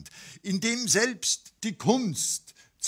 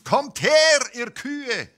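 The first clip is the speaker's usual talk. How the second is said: high, loud call across the sky; 10 semitones higher, 7 dB louder, slower than usual. A narrator's reading. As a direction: call the cows from far away. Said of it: "und rief".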